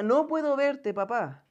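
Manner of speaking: put-on a boy calling down to his father in fear; scared child. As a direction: cry out that you can't see him. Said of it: "El niño decía".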